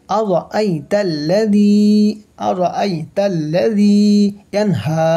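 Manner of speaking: slow chanted tajweed reading; Quran recitation. Shown as a demonstration. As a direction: draw out আরাআইতাল্লাযী melodiously. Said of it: "Quran recitation of the verse".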